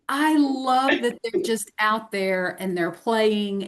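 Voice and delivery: normal talking voice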